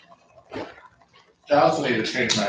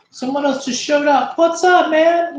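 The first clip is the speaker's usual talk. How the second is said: much higher, louder silly voice; high squeaky greeting; 7 dB louder than usual. Pretend.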